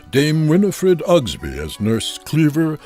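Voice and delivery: ordinary delivery, nothing marked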